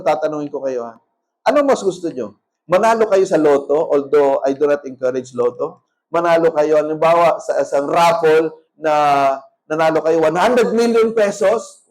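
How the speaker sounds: usual speaking voice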